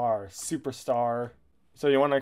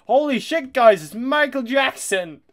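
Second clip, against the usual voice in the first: louder and higher, thrilled to spot him; excited shout; 10 semitones higher, 7 dB louder than usual. Real, not acted. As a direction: yell the discovery to chat. Excited shout at spotting [name].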